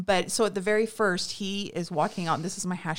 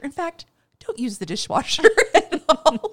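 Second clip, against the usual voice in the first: laughing mid-quote; 8 semitones higher, 7 dB louder than usual. Retold or imitated. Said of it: "He goes".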